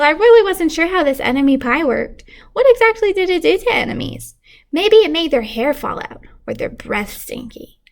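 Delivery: usual speaking voice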